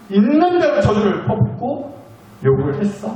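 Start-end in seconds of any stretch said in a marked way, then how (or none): 0.1-1.9 higher, indignant mock outrage; mock outraged. Preacher voicing a betrayed Jesus, mock outrage. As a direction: spit out 있는 대로 저주를 as a betrayed friend. Imagined angry Jesus venting.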